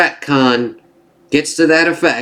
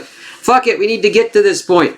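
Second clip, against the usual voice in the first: mock hasty writers; 7 semitones higher than usual. Imitating the show's hasty writers.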